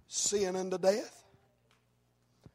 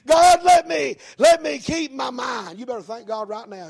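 full-force preaching; 5 semitones higher, 10 dB louder than usual. Real, not acted. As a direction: proclaim it to rouse the congregation. Preaches and cries out prayers.

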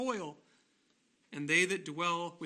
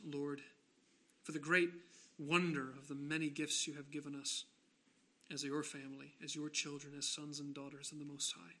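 subdued, measured pastoral prayer; hushed reverence, easing down at phrase ends; solemn prayer; 3 dB quieter than usual. Real, not acted. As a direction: lead the congregation in prayer, pausing between phrases. Leads closing prayer.

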